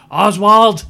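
high, loud call through a megaphone; megaphone shout. Retold or imitated. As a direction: bellow the name across the yard like a director. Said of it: "like, a megaphone".

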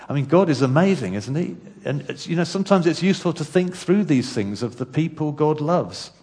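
fervent sermon build; rousing preaching. Acted. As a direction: drive each line home like a pulpit crescendo. Preaching continues after laugh.

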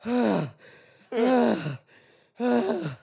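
drawn-out mock groaning; sugar-crash groans. Comic overstuffed on movie candy, groaning. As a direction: groan like you ate too much candy. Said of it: "I'm just sitting there like".

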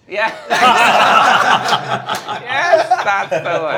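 loud burst of laughter; laughing. Real, not acted. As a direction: laugh hard through the words. Laughs at the House guess.

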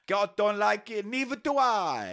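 put-on high, slow cod-accented pontiff; mock Pope. Pope ruling against the bikini, high hammed-up decree. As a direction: pronounce it slowly in a silly foreign accent. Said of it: "So say the Pope".

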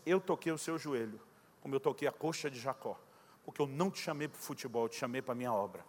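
grave e baixa, em tom solene; voz de Deus. Retold or imitated. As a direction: imita uma voz divina, baixa e grave. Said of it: "eu ouvi uma voz. Aquela voz disse". (voz divina, baixa e grave)

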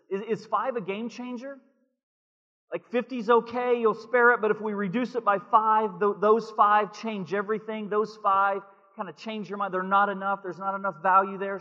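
preacher voicing Abraham pleading with God. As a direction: press the Lord, voice rising. Preacher voices Abraham's plea.

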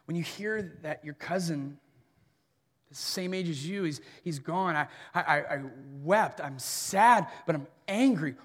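normal talking voice

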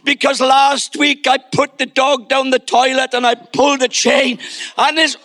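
put-on high, grovelling child voice; hammed-up abject little penitent; mock confessing son. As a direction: plead as if crawling on the carpet. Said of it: "confessing his sins, saying".

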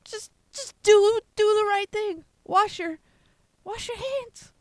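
high, exasperated, pleading; exasperated plea. Real, not acted. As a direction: beg them, fed up. Pleading with listeners to wash.

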